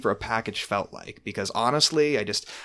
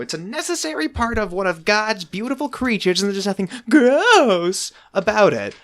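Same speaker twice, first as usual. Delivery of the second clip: put-on higher, louder prim old aunt; Aunt Tess impression; 10 semitones higher, 6 dB louder than usual. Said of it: "when Aunt Tess says".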